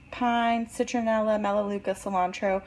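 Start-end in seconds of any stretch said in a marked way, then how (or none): none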